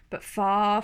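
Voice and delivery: plain reading voice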